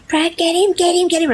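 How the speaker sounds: high frantic plea to grab the dog; panicked girlfriend. Retold or imitated. Girlfriend begging Brad to grab the dog, high and frantic. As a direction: squeal for him to catch the dog. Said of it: "Like, she's embarrassed".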